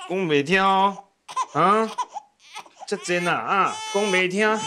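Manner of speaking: losing patience but still amused; new father scolding the baby that won't stop crying, exasperated. Acted.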